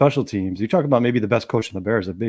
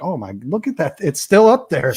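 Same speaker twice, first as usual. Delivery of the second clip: building from amazement to a raised, higher yell; retold fan yelling at the TV; excited yelling; 5 semitones higher, 5 dB louder than usual. Fan watching a punt at home, yelling in amazement. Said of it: "screaming and yelling".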